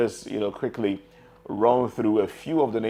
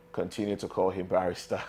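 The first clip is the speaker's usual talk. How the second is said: laughing; 2 semitones lower, 7 dB quieter than usual. Real, not acted. Host teasing guest about his title.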